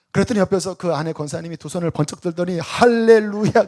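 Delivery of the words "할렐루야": slow, loud, drawn-out hallelujah; joyful hallelujah. Retold or imitated. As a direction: raise both hands and praise. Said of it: "그러시더래요". (두 손 들고 기뻐 외치는 할렐루야)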